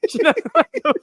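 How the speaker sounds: high squeaky mock echo of the line. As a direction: parrot it back in a high silly voice. Mimicking friend's quote, high voice.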